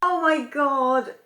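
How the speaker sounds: high, drawn-out, overcome; a real catch of happy tears; moved almost to tears; choked up. Real, not acted. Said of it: "I'm getting a bit choked up here".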